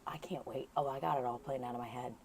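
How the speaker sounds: hushed whisper so he won't hear; excited whispering as the neighbour walks up. Real, not acted. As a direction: whisper excitedly, keep it secret. Hushed as Marty approaches.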